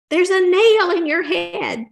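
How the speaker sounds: high, loud and slow blurt; husband finally points out the nail, loudly and slowly; loud exclamation. Acted. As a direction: burst out with the obvious.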